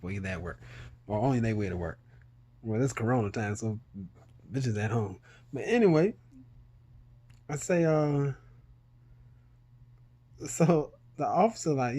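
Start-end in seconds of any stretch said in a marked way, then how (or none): none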